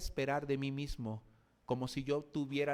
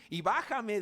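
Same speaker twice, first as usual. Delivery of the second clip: mocking thief; 7 semitones higher, 5 dB louder than usual. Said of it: "decía ese malhechor malvado".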